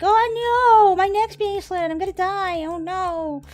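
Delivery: very high, loud, squealing panic; mock terrified scream. Streamer voices little Timmy in a hammed-up, squealing, terrified scream. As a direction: squeal like a cartoon victim about to die. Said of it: "He's like".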